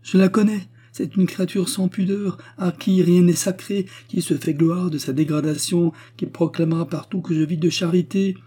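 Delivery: high-pitched, agitated denunciation; indignant tirade. A narrator's reading. Widow denouncing a rival to her hostess, indignant. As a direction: protest shrilly in wounded pride.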